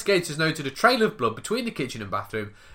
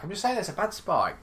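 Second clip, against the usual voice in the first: ordinary delivery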